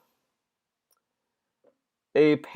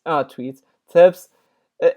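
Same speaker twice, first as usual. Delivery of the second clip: ordinary talk, nothing marked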